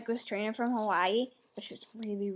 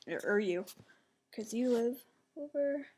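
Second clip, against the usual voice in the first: usual speaking voice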